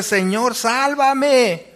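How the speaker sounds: high, drawn-out plea for rescue; acted desperate call for help; desperate cry. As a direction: cry out with your whole being, as if drowning. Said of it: "Pedro clama con todo su ser".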